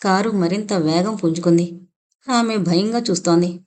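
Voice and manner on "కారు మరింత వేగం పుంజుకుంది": ordinary delivery, nothing marked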